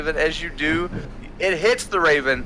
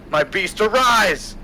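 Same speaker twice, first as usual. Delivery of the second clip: villain command; 6 semitones higher, 3 dB louder than usual. Acted. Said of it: "Von Helsing falls and goes".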